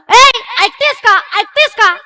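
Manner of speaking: high, eager acted call; calling out to wife. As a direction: call the wife as an excited husband arriving home. Excited husband calls his wife.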